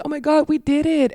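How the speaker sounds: high-pitched imitation of thrilled performers; acted elation. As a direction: squeal it lightly, like celebrating after the show. Voicing elated fellow performers after show.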